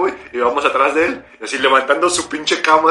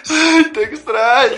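high, slow, wailing imitation; mock bawling; 10 semitones higher, 4 dB louder, much slower than usual. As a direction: cry it out, hammed up over the ex. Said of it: "llorando bien cabrón". (lloriqueo agudo y exagerado)

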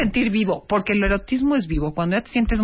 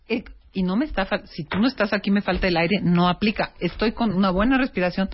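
ordinary talk

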